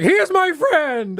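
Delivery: high, loud, slow, impassioned protest; mock scream. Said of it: "screaming at dinner".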